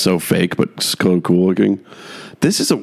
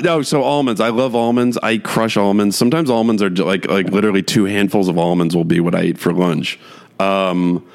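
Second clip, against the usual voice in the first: normal talking voice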